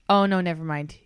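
ordinary delivery

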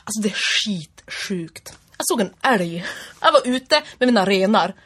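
Norrland accent. Shown as a demonstration. Imitating dialects on request, Norrland accent. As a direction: tell it as an unhurried northerner. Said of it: "Eh, norrländska".

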